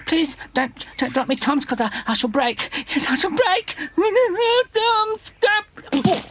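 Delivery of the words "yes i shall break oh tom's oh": high, rising alarm as he topples; panicky cries. Acted. Wellington wobbling on top of the Womble tower, panicky. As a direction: squeak out the fear of falling.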